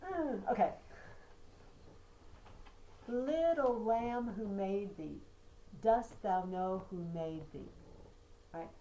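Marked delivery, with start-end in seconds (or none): 3.1-7.7 Little lamb who made thee, stressed syllables punched and slow; trochaic stress. Shown as a demonstration. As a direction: beat out the trochees, strong then weak. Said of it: "That's not da da da".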